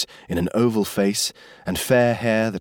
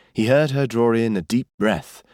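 usual speaking voice

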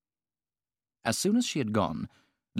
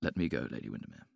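put-on lower, quick young man's offer; young gentleman; 9 semitones lower, 7 dB quieter, faster than usual. A narrator's reading. Young man offers Lady Windermere his help, eager and low. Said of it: "said a tall, handsome young man".

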